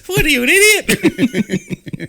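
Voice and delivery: high, loud taunting witch screech; mocking witch voice. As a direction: jeer at them like a cackling witch. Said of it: "The witch is trolling them".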